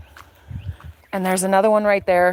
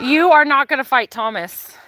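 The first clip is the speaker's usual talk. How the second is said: scolding a rooster; 7 semitones higher, 4 dB louder than usual. Real, not acted.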